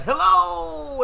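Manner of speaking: soaring high, stretched show-host hello, then slowing down; Jody's enthusiastic greeting. Retold or imitated. YouTuber borrowing another host's opener, imitating his high enthusiastic hello. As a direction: belt out the greeting like an overexcited channel host. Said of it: "every time I watch one of his videos and watch that".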